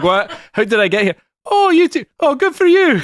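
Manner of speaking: very high, slurry just-woken exclaiming; hammed-up tipsy congratulations; drunken Jack Aurelian. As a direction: wake up tipsy and gush in a high voice. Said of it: "having just woken up out of a drunken stupor".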